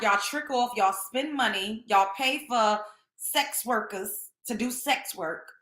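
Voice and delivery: ordinary talk, nothing marked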